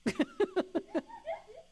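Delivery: a short, very high laugh. Real, not acted. Joking about unworthy people, a high laugh. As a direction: burst into a high giggle. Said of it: "Should be easy".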